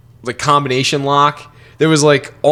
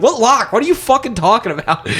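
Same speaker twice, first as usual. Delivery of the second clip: high, incredulous mock parent; exasperated bafflement; 9 semitones higher than usual. Mom questions crying son, baffled and exasperated. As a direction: imitate a parent totally lost, pitch up.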